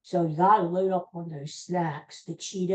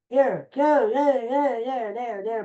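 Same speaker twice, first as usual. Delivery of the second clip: high, urgent calls to the dogs; coaxing dogs; 6 semitones higher, slower than usual. Real, not acted. Host directs his dogs to the snacks, coaxing.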